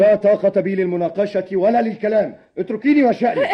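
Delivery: high, loud angry shout; furious. Acted. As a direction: shout her away, out of all patience. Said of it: "من فضلك اهدأ". (بصراخ غاضب)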